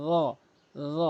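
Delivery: র said as a heavy emphatic voiced th, tongue tip to teeth tips; emphatic zha, tongue between the teeth; Arabic letter demo. Shown as a demonstration. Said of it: "জিহ্বার আগা দাঁতের আগার সঙ্গে মিলাতে হবে র".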